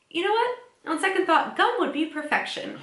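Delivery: high, playful Chandler impression; Chandler quoting. Podcast host quoting Chandler's line to her co-host, high and playful. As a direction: toss off the line like a smug sitcom quip. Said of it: "and he says".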